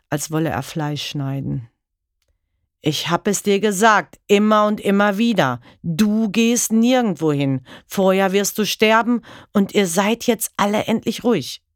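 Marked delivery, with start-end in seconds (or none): 2.8-5.6 murderous rage. Acted. Husband with a knife threatening his wife and children, enraged. 5.8-7.6 higher, louder, slow on nirgendwo; slow raging threat. Acted. Husband repeating his threat with a knife, slow and enraged. 7.9-11.6 murderous rage. Acted. Husband with a knife threatening his wife and children, enraged.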